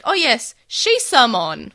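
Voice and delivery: loud, slowly drawn-out knowing oh yes; mocking the dub. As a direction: ham up the obvious reveal. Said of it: "in the dub, it's just like".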